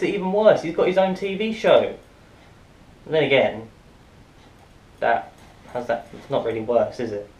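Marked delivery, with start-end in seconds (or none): none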